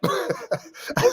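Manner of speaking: laughing high over the words. Real, not acted. Recalling blaming [name]'s people, laughing.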